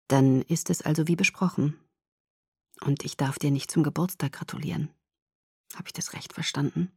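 low, hushed voice fading almost to a whisper; quiet, measured questioning; soft checking. A narrator's reading. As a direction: ask gently and very quietly. Boyfriend's quiet checking question, narrated.

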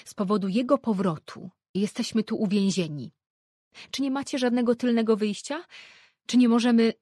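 loud, high alarm; panicked. A narrator's reading. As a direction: sound trapped and desperate. Mother panics about being trapped.

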